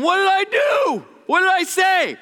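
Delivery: high, loud mock-indignant "what did I do"; indignant quote. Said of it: "They're like over there going".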